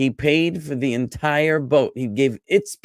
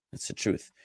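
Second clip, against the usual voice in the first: whispered under the breath; a quick whispered aside to the class; softly whispered confession; 10 dB quieter than usual. Real, not acted.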